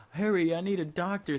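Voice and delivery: high mock cry for help; imitated urgent plea. Friend runs in the hospital, imitated urgent call. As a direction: re-enact summoning help in an emergency.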